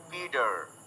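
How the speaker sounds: American "पीटर", t softened to d; low American accent with a voiced r at the end. Shown as a demonstration. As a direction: say "पीटर" American-style, as PEE-derr. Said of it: "American accent में कोई बोल रहा है".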